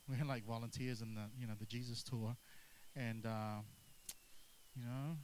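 low, soft, put-upon mutter of tired helpers; lightly voiced disciples begging to knock off; a hint of whiny volunteers wanting to go home; grumbling disciples. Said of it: "you can hear it in the tone".

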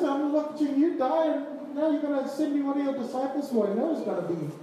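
high-pitched, exasperated imagined complaint; mock aggrieved protest. Acts Mary protesting at the cross.

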